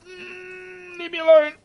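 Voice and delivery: high, whiny fake sob; mock whine. Player pestered by a character, mock whining plea. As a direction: whimper it like a pestered child.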